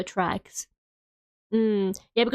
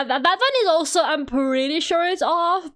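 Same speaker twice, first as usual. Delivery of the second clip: usual speaking voice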